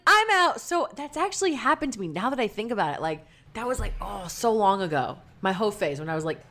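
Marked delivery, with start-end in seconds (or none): 0.1-0.6 high, loud "I'm out"; emphatic exit. Retold or imitated. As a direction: announce your exit with a yelp.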